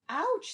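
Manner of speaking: high, loud yelp; a sudden hammed-up ouch; yelp of pain. Ant bites goat's ear.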